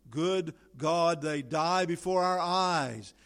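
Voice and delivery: slow, urgent pulpit cry; preacher calling men to the lifeboat; impassioned appeal. Acted. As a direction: plead for rescuers. Shipwreck appeal.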